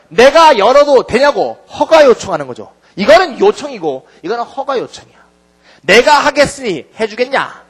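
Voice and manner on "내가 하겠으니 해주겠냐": louder, higher explaining voice; emphatic paraphrase. Shown as a demonstration. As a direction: stress the contrast for students. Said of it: "이런 얘기죠". (크게 강조하며 풀어서 설명)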